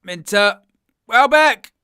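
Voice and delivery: names yelled high and loud in rising panic; real alarmed shout. Real, not acted. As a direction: shout the names as the chance opens. Brighton attack, sudden danger.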